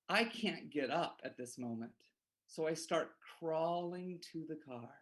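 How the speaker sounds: usual speaking voice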